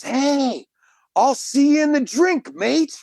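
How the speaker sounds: high-pitched mock holler of a hearty swimmer; high retold shout at ordinary volume; retold Aussie yell. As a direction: call it out high, imitating an Australian's yell. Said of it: "And he yells".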